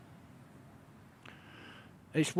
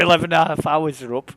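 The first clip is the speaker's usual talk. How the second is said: normal talking voice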